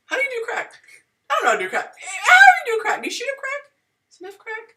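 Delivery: high, loud, baffled squeal; shrieking confusion. Real, not acted. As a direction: squeal it in disbelief. Shrieking confusion about crack.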